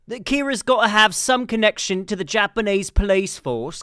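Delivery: hammed-up British detective talk; British accent. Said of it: "No, that's British".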